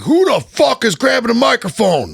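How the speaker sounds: high, loud furious yell; angry chief. Retold or imitated. Chief woken at night yelling about the microphone, furious. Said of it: "And he's like".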